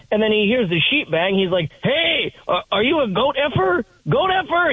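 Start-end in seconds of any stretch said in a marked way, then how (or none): 1.8-4.7 put-on high-pitched drunken outburst; mock yell, pitched way up without full volume; mock screaming. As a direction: imitate a drunk screaming an accusation. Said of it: "He starts screaming that over and over".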